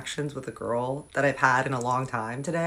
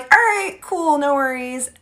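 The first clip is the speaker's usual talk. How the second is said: awkward chirpy; 9 semitones higher, 4 dB louder, slower than usual. Retold or imitated. Awkwardly backing off.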